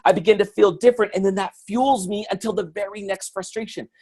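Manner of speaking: ordinary talk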